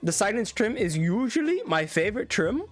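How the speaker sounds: playful mock Indian English; Indian accent. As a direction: do your best imitation of an Indian accent. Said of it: "do my best Indian accent".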